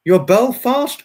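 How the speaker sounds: sudden high, loud surprise; surprised. Real, not acted. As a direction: caught off guard by the news. Learning a viewer's hometown.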